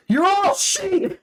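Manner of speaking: high, slow, drawn-out holler; yelling at sheep from a car; yelled out the window. Retold or imitated. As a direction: bellow at the field like a preacher. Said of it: "rolled the window down and yelled out".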